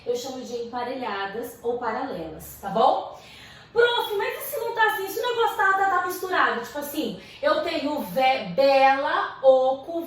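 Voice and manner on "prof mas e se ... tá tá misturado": higher-pitched imitation of a student; student questioning teacher. Teacher imitating a student asking about mixed rhymes, pitched up. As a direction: put the doubt in a higher student voice.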